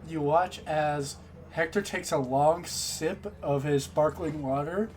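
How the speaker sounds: usual speaking voice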